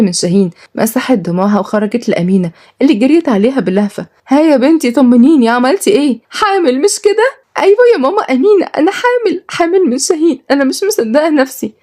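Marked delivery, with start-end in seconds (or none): 4.3-7.4 put-on much higher, eager old mother, slowing on the guess; hopeful older woman pressing for news; eager mother-in-law. Amina pressing Ghalia about the pregnancy, eager and high. 7.6-11.7 much higher, breathless with happiness, fast at first; bursting with joy; joyful news. Acted. Ghalia telling Amina she is pregnant, overjoyed and high.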